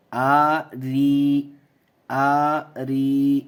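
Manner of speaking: آ ری with the ی held very long; drawn-out demo. Shown as a demonstration. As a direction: stretch آ ری slowly and loudly. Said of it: "دیکھیے کیسے".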